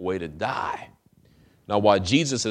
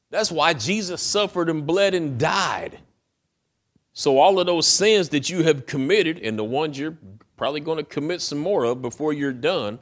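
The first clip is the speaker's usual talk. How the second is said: full-force preaching; 8 semitones higher, 6 dB louder than usual. Acted.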